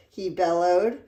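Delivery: ordinary delivery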